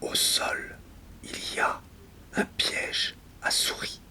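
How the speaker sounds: hushed, voiceless whisper. Acted. Speaker confiding where they are on the floor, whispered. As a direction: whisper it as a private aside.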